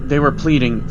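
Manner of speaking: ordinary delivery, nothing marked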